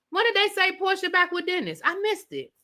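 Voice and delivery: high-pitched disbelief; baffled, voice lifted right up; surprised. Real, not acted. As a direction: question news you completely missed. Hears gossip she had missed.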